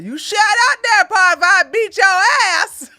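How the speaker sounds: high, loud acted threat; drawn-out shouted movie outburst; mock angry yelling. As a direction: yell it at the top of your voice, hammed up.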